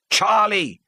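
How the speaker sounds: loud, high sharp call of a name; sharp rebuke. Acted. A father rebuking his heckling son, sharp. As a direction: snap him to order. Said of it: "Fred Elliot said sharply".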